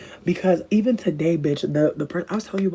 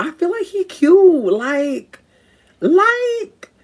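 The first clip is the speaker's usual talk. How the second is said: gushing; 13 semitones higher, 8 dB louder, much slower than usual. Real, not acted. Gushing over his cuteness.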